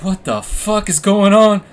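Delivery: high, loud, drawn-out disbelief; alarmed outburst. Retold or imitated. Storyteller reliving shakes and an anxiety attack, alarmed outburst. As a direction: re-enact rising panic, slowly and loudly. Retells own panic with shivers.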